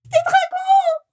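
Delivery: very high, slow terrified scream; a panicked guest screams the alarm, shrill and loud; shrill cry. Acted. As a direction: shriek the alarm in terror. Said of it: "cria la voix aiguë de quelqu'un".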